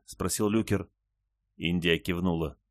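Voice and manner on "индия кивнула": usual speaking voice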